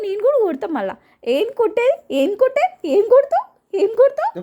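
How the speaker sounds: high, rising challenging retorts; indignant challenge. Real, not acted. Owner daring the one who threatens blows, indignant.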